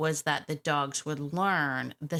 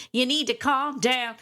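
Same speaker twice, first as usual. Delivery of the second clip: loud, higher, playful quote of the lyric; 6 semitones higher, 5 dB louder than usual. Retold or imitated. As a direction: belt the line like a pop hook. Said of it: "not to quote [name]".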